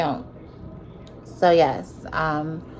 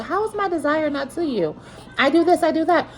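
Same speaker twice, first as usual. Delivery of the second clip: high-pitched, retold pleading rebuttal; acted protest; 9 semitones higher than usual. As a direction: argue back to a husband in a higher voice. Acts out her own rebuttal.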